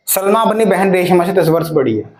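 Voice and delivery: ordinary delivery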